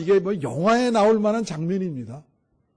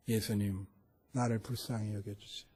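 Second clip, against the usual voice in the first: much softer and lower, barely voiced; inner prayer; 10 semitones lower, 12 dB quieter than usual. Preacher shows his midnight prayer in bed, very soft and low. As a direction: pray it silently in the head. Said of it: "머릿속에 이런 거예요".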